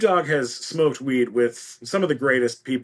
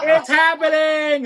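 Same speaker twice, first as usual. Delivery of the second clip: very high, loud mock squeal; retold elation; 12 semitones higher, 6 dB louder than usual. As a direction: exult as if the dream is coming true. Retells exulting to mom.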